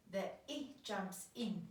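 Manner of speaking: ordinary talk, nothing marked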